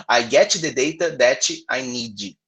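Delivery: an extra i after each final consonant, "get-chi", "that-chi", "need-ji"; teacher imitates the Brazilian mistake for students: an i after final consonants; with added i sounds. Shown as a demonstration. Slow, heavily Brazilian-accented English with added vowels. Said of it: "Se você colocar esse izinho".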